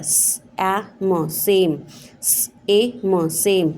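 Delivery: स, ए, म spaced out, then blended into सेम; sounding out same. Shown as a demonstration. Teacher showing the silent e. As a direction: spell out सेम by sounds, twice. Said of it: "इसमें ई की आवाज़ नहीं आई है".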